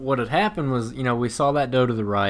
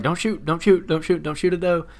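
higher, rapid-fire repeated warning; retold freaking out; 6 semitones higher than usual. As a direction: act out frantic pleading at normal volume. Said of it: "And I'm freaking out".

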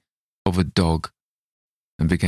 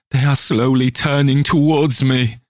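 higher and louder panicked cry; terrified shriek; 8 semitones higher, 5 dB louder than usual. Acted. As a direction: panic as they close in. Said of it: "he shrieked".

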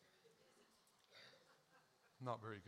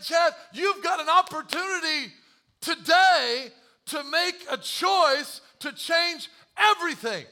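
booming divine urging; 12 semitones higher, 13 dB louder, slower than usual. Retold or imitated. God voiced in story.

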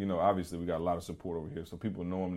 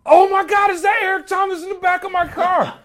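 high, loud, laughing shriek of disbelief; starstruck shriek; 11 dB louder than usual. Retold or imitated. Uber driver spotting his hero in the back seat, shrieking in disbelief. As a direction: scream it like a fan who just spotted his hero. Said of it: "almost crashes the car and was like".

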